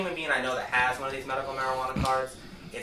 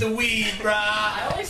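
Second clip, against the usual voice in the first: stoner voice; 6 semitones higher than usual. Retold or imitated. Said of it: "that's T J's old stoner voice".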